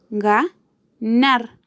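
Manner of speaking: "ganar" split into ga - nar, with a pause; sílaba por sílaba. Shown as a demonstration. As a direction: say "ganar" slowly, one syllable at a time. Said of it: "Su acento está en la última sílaba". ("ganar" separado en sílabas: ga, nar)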